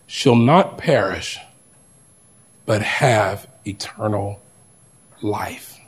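slow, solemn, fading at the end; scripture recitation. Acted. Preacher reciting a famous verse to the congregation, solemn. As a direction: lay each word down reverently.

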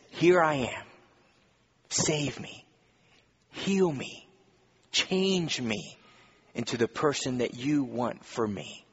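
soft, humble prayer of surrender; quiet surrender. Retold or imitated. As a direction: offer yourself quietly to God. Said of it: "an attitude that says".

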